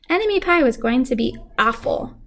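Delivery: plain reading voice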